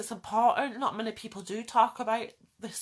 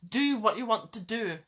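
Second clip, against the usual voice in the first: ordinary talk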